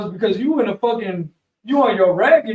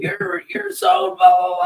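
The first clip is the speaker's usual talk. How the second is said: mock yell; 10 semitones higher, slower than usual. Mocking the yelling camper.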